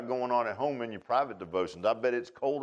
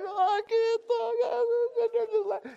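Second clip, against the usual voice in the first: very high, squeaky mock hysterics; helpless laughing. Preacher imitating someone cracking up, helpless laughing. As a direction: act too tickled to get words out. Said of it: "they're laughing".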